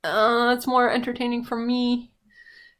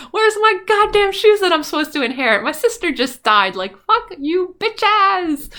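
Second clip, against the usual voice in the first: much higher, louder cackling-witch rant; furious Wicked Witch; 10 semitones higher, 9 dB louder than usual. Retold or imitated. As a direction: shriek it out like an outraged witch. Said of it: "the Wicked Witch of the West is like".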